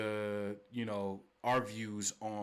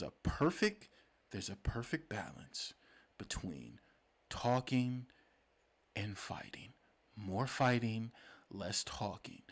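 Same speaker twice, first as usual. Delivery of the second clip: soft voice; 2 semitones lower, 6 dB quieter, slower than usual. Real, not acted. Podcast host savoring the balance of talking and fighting, soft voice. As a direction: ease down to a hushed near-whisper. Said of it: "pulled me into my soft voice".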